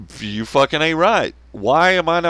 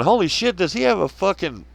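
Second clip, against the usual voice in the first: amazed disbelief; 8 semitones higher than usual. Retold or imitated. Viewer spotting an absurd weapon, astonished high outcry. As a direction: marvel at it like you can't believe it. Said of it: "And you're going".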